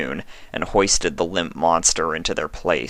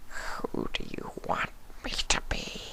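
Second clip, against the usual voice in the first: low, softer, rasping whisper; a starving creature barely able to speak; weak changeling; 14 semitones lower, 15 dB quieter than usual. A narrator's reading. Changeling asks.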